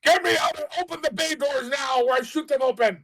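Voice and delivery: very high, strained acted yell; threatening shout. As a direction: scream a demand over the comm. Character yells threat over comm.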